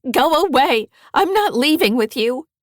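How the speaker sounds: high, loud frightened yell; girl screaming at her grandfather to leave. A narrator's reading. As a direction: shout him away in panic. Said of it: "I scream".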